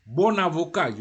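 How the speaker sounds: BON AVOCAT loud, joined by an n; liaison. Shown as a demonstration. Teacher tells learners to join BON AVOCAT, liaison. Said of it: "you have to join".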